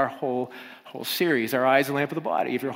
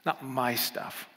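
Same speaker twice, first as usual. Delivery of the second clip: quiet; 7 dB quieter, much slower than usual. Shown as a demonstration. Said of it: "the opposite of that".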